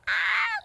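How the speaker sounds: very high, loud squeal of delight; excited shriek. Retold or imitated. Mom squealing at the star's compliment, very high and loud. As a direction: let out a shrill fangirl scream.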